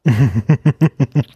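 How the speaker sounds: brief amused laugh; short laugh. Real, not acted. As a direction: laugh at the punchline. Laughs at joke.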